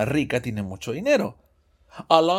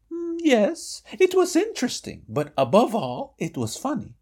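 high dialogue voice; 9 semitones higher than usual. Shown as a demonstration. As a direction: answer in a high voice. Answer voice in dialogue.

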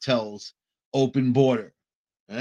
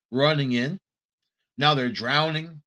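ordinary talk, nothing marked

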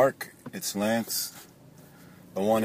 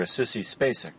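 ordinary delivery

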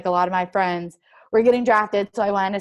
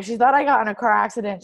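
normal talking voice

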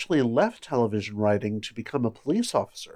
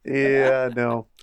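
usual speaking voice

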